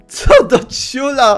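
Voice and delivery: high, loud, slow bewildered exclamation; startled loud drawn-out what the heck; baffled outburst. Real, not acted. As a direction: drag it out in disbelief. Bewildered at egg-cat.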